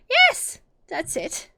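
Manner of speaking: eager Hatter. Acted. Hatter confirming Alice's guess, high and eager. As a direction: jump in eagerly.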